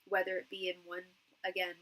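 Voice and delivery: usual speaking voice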